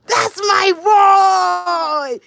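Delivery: proud hype. Real, not acted. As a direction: hype him up for the camera. Mom hyping son for camera.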